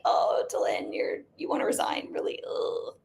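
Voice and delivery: whispered, doubtful husband, low at first; imitating skeptical husband. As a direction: breathe it like a husband who can't believe it. He initially was like.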